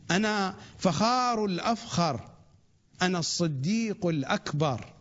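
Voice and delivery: steady rhythmic recitation, each line its own beat; sermon recitation. Acted. Reciting the Imam's sermon to the audience, in a measured litany. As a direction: recite it like a litany, one title per breath.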